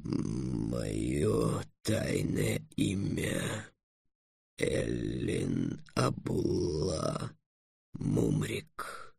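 low, soft, extremely slow dragon voice; deep, halting revelation; reluctant dragon confession. A narrator's reading. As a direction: drag each word out deep and quiet. Dragon confesses its secret name.

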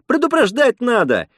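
high-pitched indignant outcry; angry cry. A narrator's reading. Lena scolding the old man for no warning, angry cry. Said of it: "выкрикнула Лена".

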